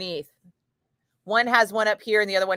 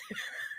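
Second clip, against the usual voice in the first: high breathy giggle; squeaky laugh; 18 dB quieter than usual. Real, not acted. Laughing at herself, high giggle. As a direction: let a tiny high laugh slip. After eggnog freakout.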